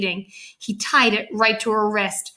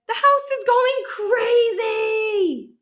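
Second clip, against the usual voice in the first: very high, slow wail; hammed-up storybook outcry; exasperated cry; 11 semitones higher, much slower than usual. A narrator's reading. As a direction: stretch it out to a long, high finish. Loretta reacts to the screaming.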